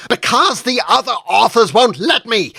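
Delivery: high, loud cry flung back; near scream. Acted. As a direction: scream it straight back at him. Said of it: "she almost screamed back".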